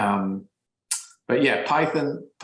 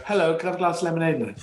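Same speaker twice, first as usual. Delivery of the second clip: put-on quick, high, polite English-accented child; neutral English accent; 5 semitones higher, much faster than usual. Child coming indoors to parents, neutral English accent. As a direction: switch to prim English at the door. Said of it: "we would accent change at the front door".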